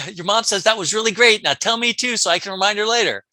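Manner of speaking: high, loud, eager relative; acted enthusiasm. Sister-in-law asking to hear good news, acted enthusiasm. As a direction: pitch it up and call it out eagerly.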